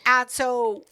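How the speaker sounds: ordinary talk, nothing marked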